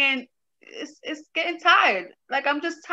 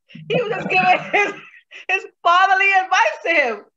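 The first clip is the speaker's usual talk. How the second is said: giggling while talking, a bit louder; laughing through words; 5 semitones higher, 4 dB louder than usual. Real, not acted. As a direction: let the laugh break into the words.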